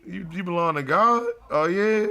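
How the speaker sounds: high-pitched sarcastic disbelief; mock incredulous. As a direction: question it with exaggerated doubt. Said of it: "I be like".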